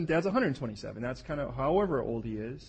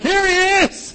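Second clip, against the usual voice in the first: much higher, loud frantic yelp; excited shout; 17 dB louder, much slower than usual. Retold or imitated. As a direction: point him out, hammed-up and loud. Isaac shouting to the angel.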